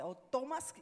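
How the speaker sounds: retold urgent command. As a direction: give a firm command, rising to the last word. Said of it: "me sacó una voz y dice".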